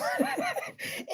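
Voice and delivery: airy high unvoiced laugh; breathy laugh. Real, not acted. Laughing about her bone with Oprah, airy laugh.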